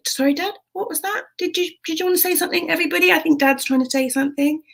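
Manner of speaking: high, quick, sing-out query; mock-innocent interruption. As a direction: leap in with exaggerated high-pitched helpfulness. Leaps in to silence dad.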